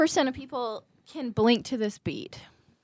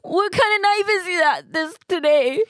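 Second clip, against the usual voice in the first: mock panic; 9 semitones higher, 5 dB louder than usual. Arm twisted skit.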